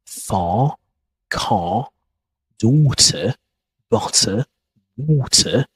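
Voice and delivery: "far", "car", "daughter", "butter", "water" with no R sound at the end, vowel held open; British non-rhotic words. Shown as a demonstration. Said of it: "We don't pronounce the letter R".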